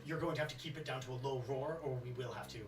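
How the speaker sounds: low polite staff voice. Acted. Staff member warns guest.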